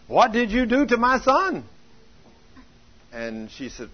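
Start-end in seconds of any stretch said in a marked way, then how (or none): 0.1-1.6 higher and louder, astonished; amazed question. Retold or imitated. Mother amazed at her calm son asks Betty again, excited and louder. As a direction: demand it in happy disbelief.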